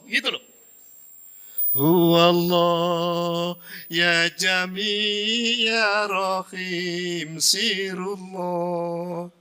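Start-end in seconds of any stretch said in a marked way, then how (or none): 1.7-9.3 drawn-out prayerful chant; slow dhikr. Shown as a demonstration. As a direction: stretch each divine name slowly. (zikir pelan dan khusyuk)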